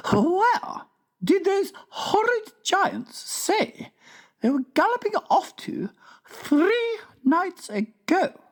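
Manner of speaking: very high, slow, prim royal voice; regal Queen. A narrator's reading. Queen tells the King about giants, high and stately.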